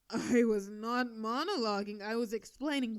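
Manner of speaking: defensive. Acted. Denies monologuing, defensive.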